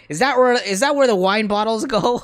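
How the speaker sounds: mock accusing mom. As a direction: imitate mom demanding an answer. Mom's pointed question, acted out.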